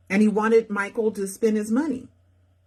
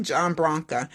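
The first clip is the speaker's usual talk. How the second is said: plain reading voice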